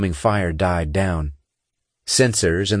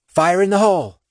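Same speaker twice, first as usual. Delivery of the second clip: warning call; 11 semitones higher, 4 dB louder than usual. A narrator's reading. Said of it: "Shar said".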